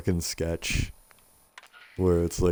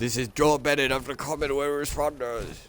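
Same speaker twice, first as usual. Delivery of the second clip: higher-pitched imitation of a radio message; mock official broadcast voice; radio distress caller; 5 semitones higher than usual. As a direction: lightly parrot a formal radio announcement. Echoes the game's radio message.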